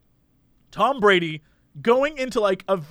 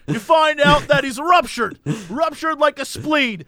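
mock scout jargon; 5 semitones higher, 3 dB louder than usual. Mocking how analysts throw out words, loud pompous parody. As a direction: ham up the scouting buzzwords like a blowhard. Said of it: "they just throw out words like".

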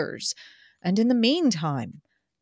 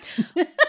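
high burst of laughter; 11 semitones higher, 4 dB louder than usual. Real, not acted. Reader breaks up laughing.

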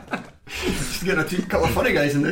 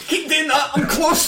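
mock aroused; 14 semitones higher, 4 dB louder, slower than usual. Mock reaction to the impression.

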